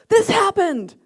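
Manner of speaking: high, loud angry outburst; worked-up, mock-furious yell. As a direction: re-enact yelling down the phone at a daughter. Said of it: "You're yelling at me".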